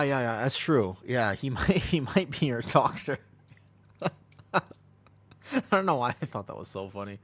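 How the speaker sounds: ordinary delivery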